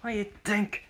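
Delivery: high-pitched annoyed challenge; worked-up, mock-angry rival; pissed. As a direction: take them on, irritated and high. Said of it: "getting pissed".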